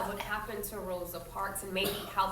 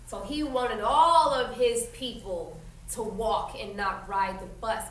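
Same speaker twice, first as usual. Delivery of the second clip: usual speaking voice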